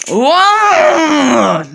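loud whoa; surprised shout. Real, not acted. Player reacts to a sudden event, loud whoa.

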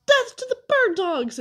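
high, loud mock-assassin threat; menacing war cry. A narrator's reading. Myron ambushing his captive as chief assassin, menacing war cry. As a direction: proclaim it shrilly as an ambush cry.

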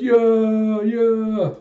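high, loud drawn-out cheer; imitated rousing cheer, held long; crowd cheer. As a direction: cheer along like a whole crew. Crew cheering in reply.

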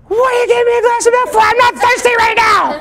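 high, loud, fast scolding mother; exasperated mom. Retold or imitated. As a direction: snap at the kid in disbelief. Mom angry about the milk.

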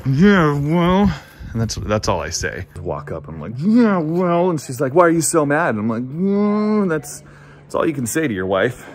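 usual speaking voice

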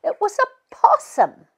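put-on teasing the nervous boy; snorting scoff. A narrator's reading. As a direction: laugh off his fear with a scoff. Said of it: "She snorted".

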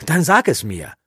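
loud, high demand. Acted. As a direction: insist on an answer. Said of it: "forderte Eragon ihn auf". (fordernd, laut und hoch)

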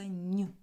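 н said alone as a soft palatalised "ny"; soft consonant alone. Shown as a demonstration. Teacher showing pupils the soft consonant, palatalised sound. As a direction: isolate н and soften it clearly. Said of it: "Він м'який".

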